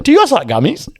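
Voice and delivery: high, swooping imitation of an uncle; mock-casual uncle, voice lifted high; uncle's offer. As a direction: pitch it up and swing into the question.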